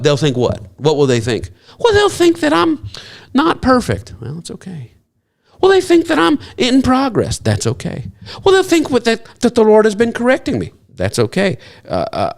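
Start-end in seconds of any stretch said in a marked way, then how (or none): 1.8-4.1 high-pitched, drawn-out mock dread; hammed-up fretting, pitched way up; mock worry. Mocks fear of others' opinions. 5.6-7.4 hammed-up fretting, pitched way up; mock worry. Mocks fear of others' opinions. 8.4-10.7 hammed-up fretting, pitched way up; mock worry. Mocks fear of others' opinions.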